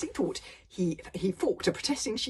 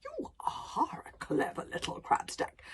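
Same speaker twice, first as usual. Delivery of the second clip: drowsy whisper; 10 semitones higher, slower than usual. Acted. Drowsy dragon.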